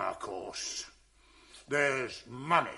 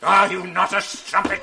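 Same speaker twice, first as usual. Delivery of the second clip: loud accusing question; angry shout; 6 semitones higher, 11 dB louder than usual. Acted. Husband shouts accusation at wife, angry. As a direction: hurl the accusation.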